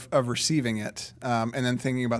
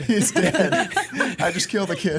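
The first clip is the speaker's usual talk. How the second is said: high, loud panic through laughter; hammed-up dismay; mock panic; 7 semitones higher, 6 dB louder than usual. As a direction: play horrified while laughing. Listener echoes the retold panic.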